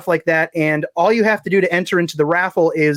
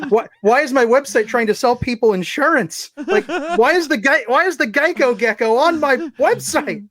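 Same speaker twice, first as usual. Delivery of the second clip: incredulous outrage; 7 semitones higher than usual. Retold or imitated. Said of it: "I'm like".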